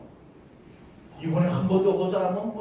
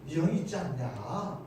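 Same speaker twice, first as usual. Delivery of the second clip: soft, soothing question; easygoing and softly spoken; gentle, kindly tone; 3 semitones lower, 6 dB quieter than usual. Shown as a demonstration. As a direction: say it gently, as if reassuring. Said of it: "이렇게 푸근한 말로 할 수도 있어요".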